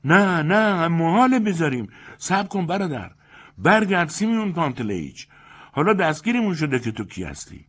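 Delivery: high, loud urgent begging; don't let him leave; desperate fawning insistence; insistent pleading. Acted. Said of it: "با اصرار گفت".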